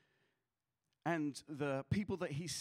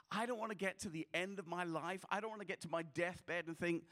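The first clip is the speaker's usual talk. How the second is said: ordinary talk, nothing marked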